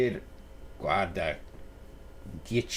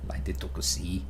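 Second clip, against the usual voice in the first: whispered self-critique, lower; cringing whisper; 6 semitones lower, 4 dB quieter than usual. Retold or imitated. Guest whispering at his own accent, cringing. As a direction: hush it like you're embarrassed at yourself. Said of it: "I cringe a bit and go".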